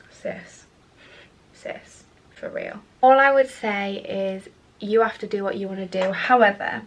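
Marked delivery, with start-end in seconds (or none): 0.1-2.8 soft whispered sis, for real; whispered aside. Real, not acted. Whispering to her viewers about going back to exes.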